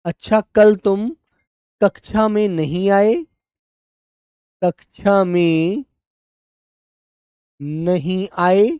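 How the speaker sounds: sentence read slowly for copying; slow dictation. Shown as a demonstration. Teacher dictating example sentence, slow and even. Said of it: "वहां पर हम अल्प विराम का प्रयोग करते हैं ऐसे".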